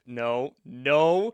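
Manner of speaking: firm, loud double no; loud refusal. Real, not acted. Stops own stepbrother joke, chiding chat.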